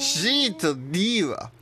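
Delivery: slow, loud, high indignation; indignant. Pretend. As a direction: drag it out in outrage. Mock indignant quiz host. (大げさに憤慨して)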